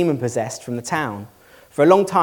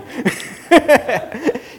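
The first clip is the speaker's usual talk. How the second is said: high-pitched amused laugh; real laugh; 11 semitones higher, 3 dB louder than usual. Real, not acted. Preacher laughing with congregation at own remark, amused laugh. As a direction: laugh freely after your own punchline.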